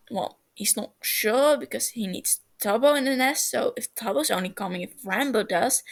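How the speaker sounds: usual speaking voice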